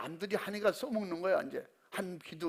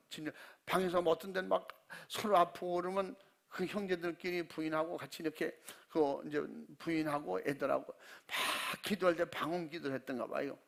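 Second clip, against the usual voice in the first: ordinary talk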